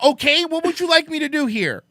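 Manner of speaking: high, loud exasperated question. Retold or imitated. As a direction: throw it back at him in disbelief. Exasperated quote repeated.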